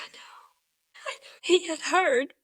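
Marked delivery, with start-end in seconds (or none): 0.0-1.3 real crying, barely any voice; tearful whisper. Real, not acted. Wife recalls answering the man helping her husband, tearful. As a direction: let it collapse into a very soft, tearful whisper.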